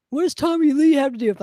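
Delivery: indignantly brushing off the comparison; mock indignant question. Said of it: "You're like".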